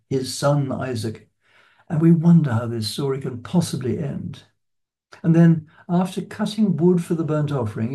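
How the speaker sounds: ordinary talk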